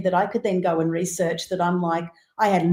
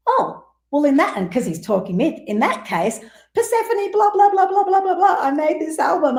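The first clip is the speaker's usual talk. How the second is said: higher, tumbling excited chatter; gushing excitement; 10 semitones higher than usual. Retold or imitated. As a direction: spill everything out in a rush of delight. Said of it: "And I'm like".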